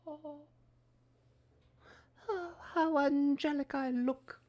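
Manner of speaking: high, slow, airy self-admiration; mock angelic. Streamer admiring his new camera tone in a high mock voice. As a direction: coo at your own reflection.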